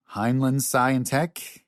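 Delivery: slow, rising, questioning hints; tentative prompting. A narrator's reading. Scott prompts the puzzled professor.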